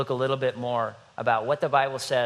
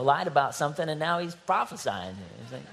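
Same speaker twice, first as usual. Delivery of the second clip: ordinary talk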